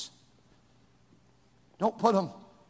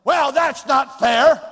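louder, slow mock protest; whining complaint; 5 semitones higher, 8 dB louder, slower than usual. Preacher imitating a student complaining about fairness, mock whine. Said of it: "But, but".

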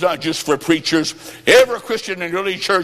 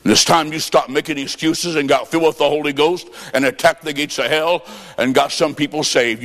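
ordinary talk

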